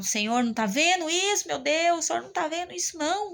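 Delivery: high, pleading cry to God; begging the Lord through tears, pitched high; crying prayer. Retold or imitated. Caregiver praying to God over her mother, tearful plea. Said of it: "Eu cheguei a falar assim, chorando".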